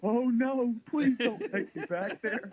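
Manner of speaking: high, loud, hammed-up wail of dread; mock panicked pleading. Patient begging the eye doctor in mock panic. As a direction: beg in exaggerated horror, dragging the words out.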